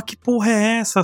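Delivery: high, baffled exclamation. Retold or imitated. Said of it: "E eu ficava".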